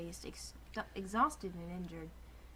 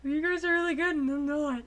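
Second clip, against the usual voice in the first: laughing; 7 semitones higher, 9 dB louder than usual. Real, not acted. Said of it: "I'm not good at them".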